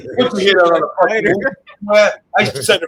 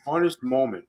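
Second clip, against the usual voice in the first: low, soft, resigned repeat; muttered disbelief; 6 semitones lower, 10 dB quieter than usual. Real, not acted. Repeating the hosts' odd word back, muttered disbelief. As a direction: mutter it to yourself, lower and softer.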